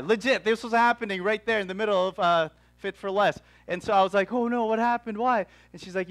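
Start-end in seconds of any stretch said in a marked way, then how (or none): 4.3-5.5 higher-pitched imitated dismay; retold alarmed concern. Preacher replaying reaction to receptionist's tears, alarmed concern.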